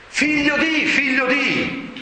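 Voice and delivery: high-pitched calling out; God calling, pitched well up; acted divine cry. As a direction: call it out twice, stretching the repeat. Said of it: "Quindi Dio gli urla".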